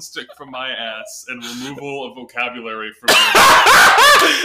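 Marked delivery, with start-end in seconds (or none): 2.9-4.5 loud, shrieking laugh on the last words; cracking up; laughing hard. Real, not acted. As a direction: burst into loud high-pitched laughter. Joking, hysterical laughter.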